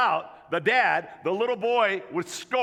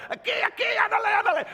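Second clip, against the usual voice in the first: yelling in Spanish; 13 semitones higher, much faster than usual. Retold or imitated. Dad yells to the boy running the wrong way, high shout. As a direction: yell it across the field to drown out the other dad. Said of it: "yelling out the only Spanish words I know".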